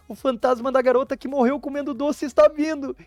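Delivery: high, panicked scream. Acted. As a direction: shriek it while running away. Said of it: "E ela começa a gritar". (grito em pânico)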